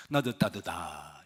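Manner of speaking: botched leader line. Shown as a demonstration. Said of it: "이러면 뭐 신-, 그 아무런 권세도 안 생기죠".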